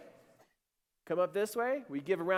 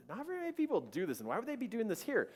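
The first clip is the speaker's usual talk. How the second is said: puzzled wondering; 6 semitones higher, 3 dB quieter, faster than usual. Retold or imitated. Dad puzzling over strangers.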